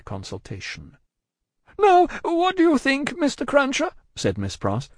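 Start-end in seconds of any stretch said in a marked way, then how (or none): 1.8-3.9 high, agitated character voice; agitated woman. A narrator's reading. Miss Pross asking Cruncher for his opinion, highly agitated. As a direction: pitch it far up, anxious and urgent. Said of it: "whose agitation was so great".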